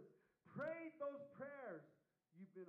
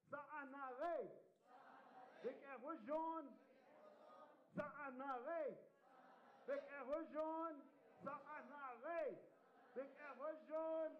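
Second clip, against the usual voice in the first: prayer call-out; 4 semitones higher than usual. Shown as a demonstration. Leader drills the audience in prayer phrases, raised chanting voice. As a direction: call the prayer firmly for a group to echo. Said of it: "Just repeat after me".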